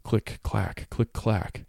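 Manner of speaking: soft, hushed rattling words; quiet. A narrator's reading. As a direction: let it rattle softly. Story bones rattling sound.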